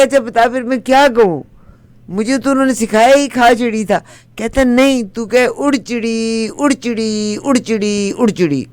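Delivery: high, complaining voice. Acted. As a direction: whine it up high after the beating. Beaten man pleads, farmer instructs.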